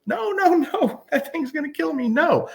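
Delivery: very high, tiny dog voice, panicked; the little Chihuahua refusing the collar, in a squeaky high voice. Retold or imitated. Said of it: "he was like".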